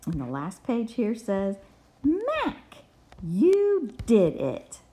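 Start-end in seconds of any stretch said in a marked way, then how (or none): 2.0-4.8 much higher, slow cheer; story character celebrating Mac's bread, with excitement; very excited. Acted. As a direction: read it like a delighted child cheering a friend. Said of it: "He's very excited".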